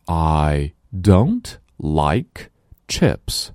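very slow, careful reading for a listening test; slow dictation reading. Shown as a demonstration. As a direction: read it slowly so pupils can pick out "chips". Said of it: "我来读，然后你告诉我". (极慢的听力示范朗读)

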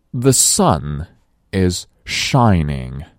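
slow, careful model sentence for learners; slow model reading. Shown as a demonstration. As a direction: read it out slowly for the class. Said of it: "我们再来大声朗读". (慢速示范朗读)

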